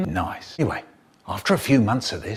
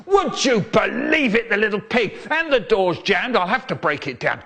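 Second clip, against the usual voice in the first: roaring; 7 semitones higher than usual. Retold or imitated. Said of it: "he's roaring".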